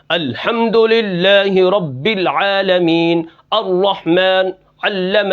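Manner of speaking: slow, hard-pressed recitation with heavy syllables; harshly forced articulation. Shown as a demonstration. Teacher shows students a recitation fault, pronouncing words with harshness. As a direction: bear down hard on each word as you recite. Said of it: "کسی کلمے کو سختی سے ادا کرنا".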